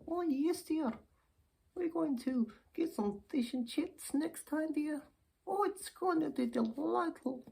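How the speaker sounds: put-on very high-pitched, eager old dear; dotty old lady. Plays dear-saying old lady.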